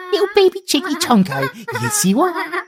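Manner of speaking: squeaky pet talk with a laugh. Real, not acted. Cooing to the pet Scarlet.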